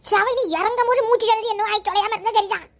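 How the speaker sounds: normal talking voice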